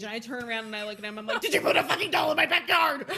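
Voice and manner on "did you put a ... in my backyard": louder, higher frantic demand, laughing; Dumbledore on a rampage. Retold or imitated. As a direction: fling the accusation at dad, half laughing. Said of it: "Dumbledore is still on a rampage".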